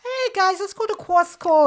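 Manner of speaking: high, loud put-on voice saying Quast and Costco; hammed-up imitation calling out to the guys; mock accent. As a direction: parody her way of saying Costco. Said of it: "You're saying Quas".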